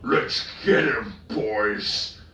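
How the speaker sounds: high, loud rallying yell; orc yell. A narrator's reading. Orc rallying his mates to charge, loud yell. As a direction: whip the boys into a charge. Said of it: "another orc yelled".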